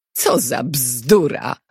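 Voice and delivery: understated indignant outburst; exclaiming. A narrator's reading. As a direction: drag out a mild outcry of disbelief. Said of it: "wykrzyknęła Elżbieta".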